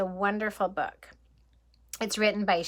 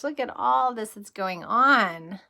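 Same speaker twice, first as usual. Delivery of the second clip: plain reading voice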